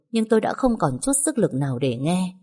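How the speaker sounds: usual speaking voice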